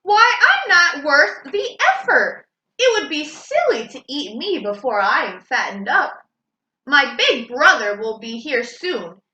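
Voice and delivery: a little goat talking his way out of danger; little goat pleading. Acted. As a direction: beg the troll to wait for the bigger one.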